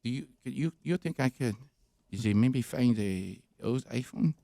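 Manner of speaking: imitated French boy, hesitant English; halting French accent. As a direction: grope for words in a French accent. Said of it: "he can't do the flow of it".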